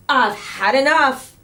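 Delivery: loud, peeved outburst; a fed-up teacher snapping. A narrator's reading.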